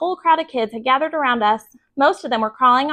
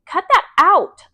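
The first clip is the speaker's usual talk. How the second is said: annoyed protest; 7 semitones higher, 5 dB louder, slower than usual. A narrator's reading. Peter annoyed at teasing.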